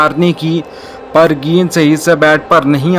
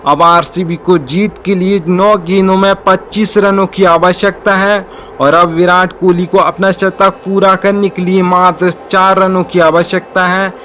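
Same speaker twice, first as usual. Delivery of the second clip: ordinary talk, nothing marked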